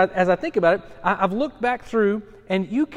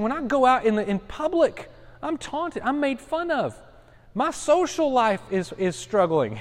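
higher, pleading voice of a mocked man; Jeremiah's lament; 6 semitones higher than usual. Preacher voicing Jeremiah taunted in public, higher and pleading.

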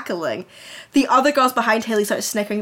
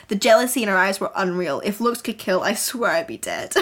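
ordinary talk

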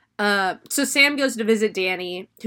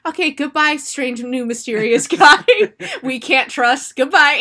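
louder dismissive shooing; mocking Chad; 4 semitones higher, 5 dB louder than usual. Chad shooing strange guy out, dismissive. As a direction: shoo him out with a laugh. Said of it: "'cause Chad goes".

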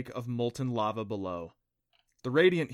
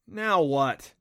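loud, exasperated groan of a question; boy frustrated the trident won't work; complaining; 3 semitones higher, 7 dB louder than usual. Acted. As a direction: whine it out in frustration. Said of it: "he complained".

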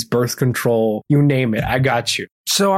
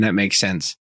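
normal talking voice

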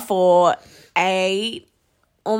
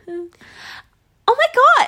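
very high, drawn-out gush; way up there; 13 semitones higher, 5 dB louder, much slower than usual. Retold or imitated. Demonstrating customer service voice, squeaky-high and slow. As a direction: stretch it out high and loud. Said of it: "it's, like, up there".

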